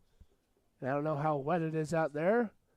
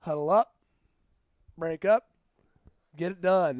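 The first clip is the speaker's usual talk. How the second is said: louder, clipped rallying call; mock team call; 6 dB louder than usual. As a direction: bark it like the team's own huddle chant. Voicing team's chant.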